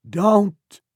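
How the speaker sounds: sharp, high, loud warning yell; shouting woman. A narrator's reading. Woman in the grass warns strangers, shouting. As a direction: cry out to stop them. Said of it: "shouted the woman".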